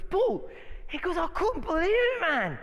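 a playful Afrikaans-British lilt on the friend's words; Afrikaans British accent. Retold or imitated. Pastor imitating his Zimbabwean friend, light Afrikaans-British accent. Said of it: "Afrikaans slash British accent".

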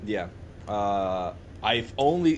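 ordinary talk

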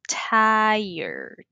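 "Tired" stretched slowly as "TAI-erd"; pronunciation model. Shown as a demonstration. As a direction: draw out "Tired" for a learner to repeat, long "ai". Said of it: "¿Puedes repetir?". ("Tired" alargado para repetir, "tái-erd")

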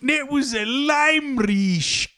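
hammed-up, mangled Australian accent; Johnny Australia. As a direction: stretch the name out like a cartoon Aussie. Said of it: "from Johnny Australia".

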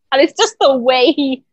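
ordinary talk, nothing marked

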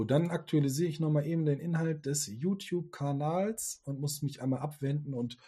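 ordinary talk, nothing marked

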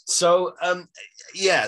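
ordinary talk, nothing marked

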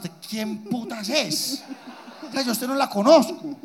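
put-on a kid snapping at a stranger nurse; indignant kid, mock rude.

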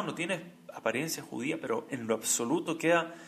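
ordinary delivery

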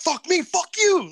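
high, loud mock outburst; spurt of rage. Storyteller imitates his girlfriend cursing at another driver, raging high and loud. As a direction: imitate a sudden burst of cursing, stretched out. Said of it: "say fucking spurts of rage".

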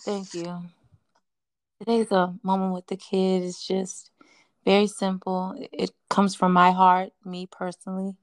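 calm counselor giving wisdom; therapist voice. Pretend. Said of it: "I tried to stay in my therapist voice".

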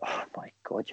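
hushed, breathless whisper of disbelief; retold whisper from the skipper, stunned; shocked whisper. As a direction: whisper it like you can't believe it.

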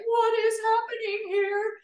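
high, startled cry of disbelief; alarmed disbelief. Retold or imitated. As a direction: voice jumps way up in shock. Retelling her own fear at tumors.

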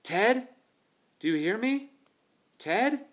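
high, loud call into the jungle; anxious calling. Acted. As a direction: call out for a missing friend, worried. Boy calling for lost friend.

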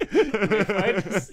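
words through laughter, slow; laughing. Real, not acted. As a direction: giggle through the retake.

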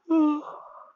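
big audible yawn. Real, not acted. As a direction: let out a long, high yawn. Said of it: "huge yawn of the day".

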